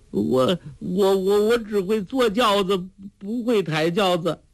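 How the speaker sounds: high, stuttering helpless plea; stammering protest. Acted. Fat lady excuses herself from carrying the sedan, high stammer. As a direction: stumble over the first word, pitched high.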